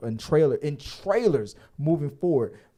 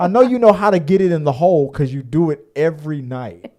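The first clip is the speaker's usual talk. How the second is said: imitating a teasing girlfriend; 2 semitones higher, 8 dB louder than usual. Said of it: "is what she said".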